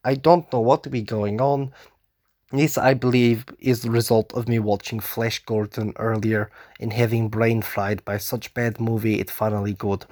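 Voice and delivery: put-on deliberately poor Russian accent, dropped words; bad Russian accent. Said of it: "now I do this in Russian accent and it's not very good".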